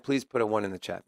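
normal talking voice

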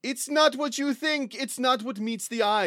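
high, loud mysterious build-up; hammed-up tour guide reveal; dramatic reveal. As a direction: unveil a secret with high drama.

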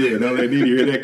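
ordinary talk, nothing marked

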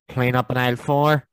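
slow, loud tannoy call; supermarket announcement. Pretend. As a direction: call it over the store speakers. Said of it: "the Tannoy in Tesco's".

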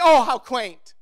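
a hint of a scoffing critic; mocking dismissal. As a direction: sneer at it as quaint. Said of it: "they say".

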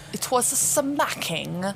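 put-on slow British accent, "smacking" drawn out. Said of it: "saying it with a British accent is really funny".

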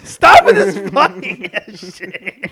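slow, laughing complaint; laughing exasperation. Real, not acted. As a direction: plead through laughter. Friend keeps messing joke up.

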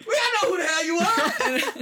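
high-pitched mock heckle; heckler's shout. Heckler to an unknown performer, mock shouting. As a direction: shout back as a dismissive crowd member.

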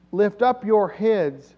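psalm recitation. Acted. Preacher reciting the priests' psalm, slow and loud.

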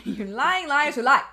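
loud, laughing fake outrage; laughing mock accusation. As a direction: shout it through a laugh.

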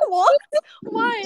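high, loud, incredulous "what" and "why"; retold bafflement. Friend replaying her reaction to an odd warning, baffled. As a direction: raise the pitch in baffled disbelief.

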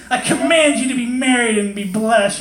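high, laughing mock decree; hammed-up divine order through giggles; mock command. God commanding marriage, laughing mock decree. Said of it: "so funny".

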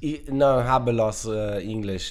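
slow English with a fake Spanish accent. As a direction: lay on a pseudo-Spanish accent. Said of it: "mit einem pseudospanischen Akzent". (mit pseudospanischem Akzent)

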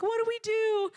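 hammed-up panicked cry, high and loud; mock panic. Homesteaders in a van asking what to do, mock panicked. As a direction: squeal it like a family at a loss.

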